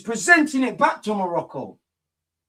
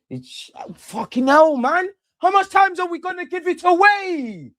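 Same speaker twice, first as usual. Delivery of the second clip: exasperated outburst; 13 semitones higher, 4 dB louder than usual. Real, not acted. Frustrated at giving ball away.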